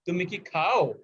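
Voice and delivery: খাও said with extra weight at the end; yes-no question, stress on খাও. Shown as a demonstration. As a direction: lean on খাও, the verb. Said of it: "প্রথমটা stress পড়বে খাও ভার্বের উপরে".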